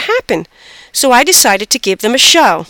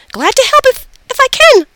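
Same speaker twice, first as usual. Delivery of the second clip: very high, eager little voice; squeaky hamster; 4 dB louder than usual. A narrator's reading. Hamster offers the girl help, high squeaky voice. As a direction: squeak it like a tiny animal. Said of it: "I squeaked to her".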